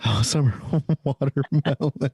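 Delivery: amused echo broken by a laugh. Retold or imitated. Co-host echoing a pop song title to friends, laughing. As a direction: draw out the title and laugh through it.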